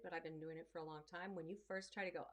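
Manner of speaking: ordinary talk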